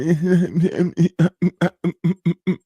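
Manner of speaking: real amused laughter; laughing. Real, not acted. Streamer laughing at his own impression.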